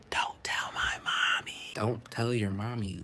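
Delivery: put-on slow, hushed child's secret; secretive whisper. Little girl swears playmate to secrecy, slow whisper. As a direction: whisper it so no grown-up hears.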